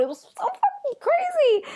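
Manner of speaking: high, keyed-up exclamation; hyped squeal of disbelief; excited outburst. Real, not acted. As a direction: burst out with it, thrilled. Opening outburst about the episode.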